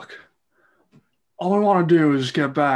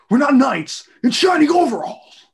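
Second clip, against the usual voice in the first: higher, louder exasperated protest; 10 semitones higher, 6 dB louder than usual. Acted. Mario scolding Luigi that they're just plumbers, exasperated and loud. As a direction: push back hard on your brother.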